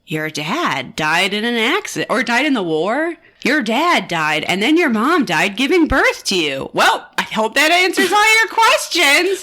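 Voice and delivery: hammed-up cheer, rising to a big sign-off; excited doctor. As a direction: break awful news with mock excitement. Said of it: "he seems kind of excited to tell her".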